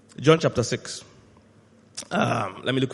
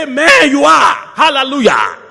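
high, shouted mock agreement; imitating eager church responses at full voice; cheering congregation; 10 semitones higher, 10 dB louder than usual. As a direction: holler back like a fired-up congregation. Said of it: "they say".

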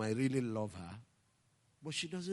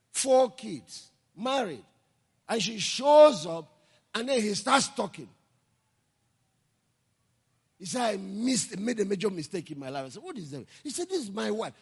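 higher, louder sermon retelling; preaching with fervour; 10 semitones higher, 10 dB louder than usual. Acted. Pastor recounting the man's confession to the congregation, fervent preaching. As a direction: drive the story home to the church.

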